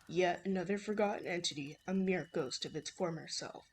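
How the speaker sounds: low-voiced old man; man imitation. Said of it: "my horrible man imitation".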